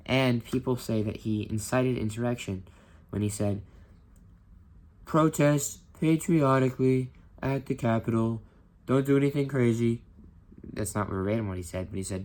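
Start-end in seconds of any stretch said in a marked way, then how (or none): 5.0-10.0 lightly voiced blustering politician; mock Trump voice. Teacher imitating Trump's Capitol speech, lightly hammed-up.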